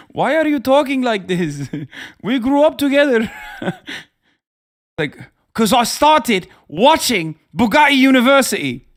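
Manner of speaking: high, loud bewildered buddy with a laugh; mock exasperated friend. Ahmed asking his hustler friend why he talks that way, laughing. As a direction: play the baffled friend breaking into laughter. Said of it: "Ahmed's like".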